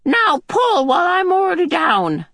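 very high, shouted command; ordering old woman. Acted. Mrs Watson ordering Mrs Murray, high shouted order. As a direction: bark the order like a bossy crone. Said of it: "ordered Missus Murray".

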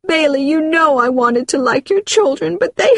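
high tearful pleading, climbing at the end; distraught, high-pitched protest; crying. Acted. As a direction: plead tearfully until the words break off. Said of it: "she was crying".

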